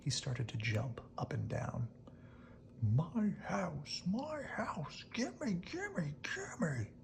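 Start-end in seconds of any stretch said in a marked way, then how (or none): 2.8-6.9 put-on high, whispered childish tantrum; hushed mock yell, very high. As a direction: chant it like a stamping toddler. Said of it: "he yelled".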